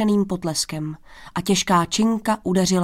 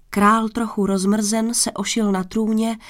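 plain reading voice

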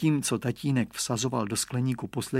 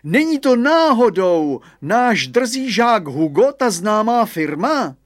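loud, high, slow headmaster's query; calling out; 9 semitones higher, 7 dB louder than usual. A narrator's reading. Headmaster asks if that is cheeky pupil Hugo, calling out. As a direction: call it over the fence, drawn out.

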